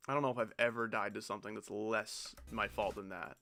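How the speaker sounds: ordinary talk, nothing marked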